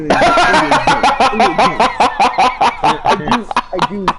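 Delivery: loud heated insistence; shouting. Real, not acted. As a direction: yell the point across, laughing at the end. Loud heated argument about cheating.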